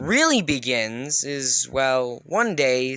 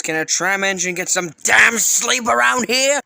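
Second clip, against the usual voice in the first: raised, higher-pitched grumpy complaint; irritated outburst; 5 semitones higher, 5 dB louder than usual. Acted. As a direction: snap at the noisy pair for sleep. Sleepy engine snaps at noisy pair.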